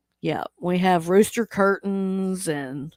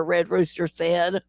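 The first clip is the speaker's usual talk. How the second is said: usual speaking voice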